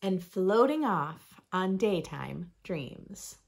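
lively, sing-song picture book reading; reading to children. Acted. Reading a rhyming book to young listeners, bright storytime voice. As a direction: read it like storytime for little ones.

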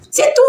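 high squealing giggle; squeaky giggle. Real, not acted. Laughs at her own phrase.

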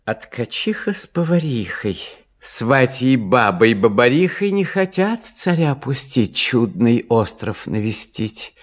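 plain reading voice

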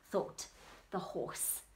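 usual speaking voice

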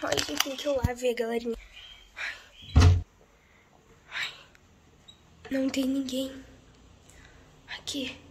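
soft, nervous sneaking voice; hushed. Acted. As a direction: creep along whispering. Sneaking down to check a noise.

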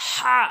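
loud, high হা squeezed from mid-throat. Shown as a demonstration. Teacher showing what must be said, forceful throaty হা. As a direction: push হা hard from the middle of the throat. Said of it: "তো বলতে হবে".

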